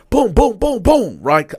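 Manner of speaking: punching sounds. Retold or imitated. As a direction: pound out each boom. Punching the metal bed bar.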